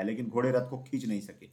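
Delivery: usual speaking voice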